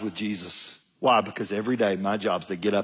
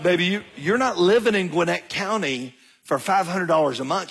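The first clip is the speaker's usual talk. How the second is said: mild exasperation; 7 semitones higher than usual. Retold or imitated. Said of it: "and so I'm like".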